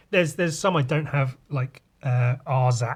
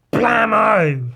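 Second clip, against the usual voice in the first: much higher, much louder comic-book blast; shouted gunshot; 8 semitones higher, 9 dB louder than usual. Acted. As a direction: yell it like a cartoon gunshot. Comic gunshot sound effect.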